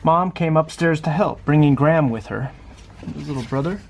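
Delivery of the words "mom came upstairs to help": usual speaking voice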